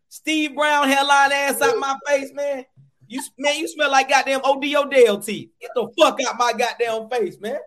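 mock angry rant. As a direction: rail at them in hammed-up disgust. Comic rant at a musty person.

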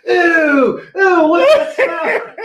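put-on high, loud childish squeal of disgust; disgusted kid. Ten-year-old son recoiling from dad's smell, shrieking. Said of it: "I'm like, I'm like".

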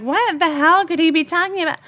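high-pitched reenacted bafflement; baffled disbelief. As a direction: act out being utterly puzzled, voice jumping high. Acted baffled reaction to text.

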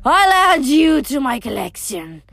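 monstrous snarl in an altered voice; snarled threat. A narrator's reading. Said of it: "she snarled".

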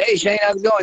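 high-pitched casual phone hello; retold chirpy hello; breezy phone greeting. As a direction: open the call high and chatty. Retold phone small talk opener.

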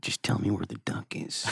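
unvoiced whisper, calming then threatening; menacing whisper. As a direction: hush them, then quietly demand the duck. Mock Liotta whispering threat.

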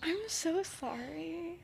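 breathless upset whisper; real whisper. Real, not acted. Teller apologising to friend mid-story, upset whisper. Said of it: "Oh my gosh".

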